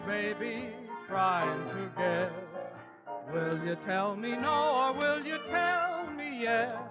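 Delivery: ordinary talk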